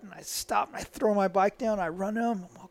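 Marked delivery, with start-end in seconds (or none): none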